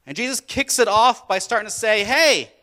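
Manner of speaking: loud, high preaching surge; full-force preaching. Acted. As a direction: ramp up and preach hard. Preaching builds again.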